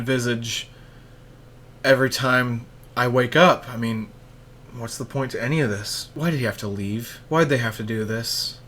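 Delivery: hammed-up despair over a cancelled show; mock depression. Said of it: "Step four".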